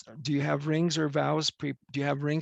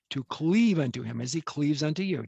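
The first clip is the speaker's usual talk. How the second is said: ordinary delivery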